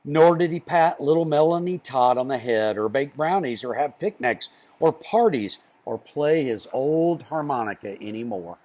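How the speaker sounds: usual speaking voice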